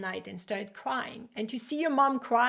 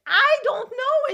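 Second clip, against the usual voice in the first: put-on excited child; 8 dB louder, slower than usual. Child answering mom's question.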